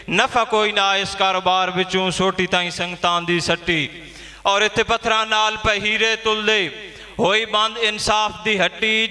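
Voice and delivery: high, sustained verse declamation; poem recitation. Acted. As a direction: recite it to the whole gathering. Poet recites verse at gathering.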